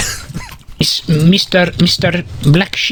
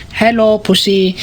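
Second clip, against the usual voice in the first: ordinary talk, nothing marked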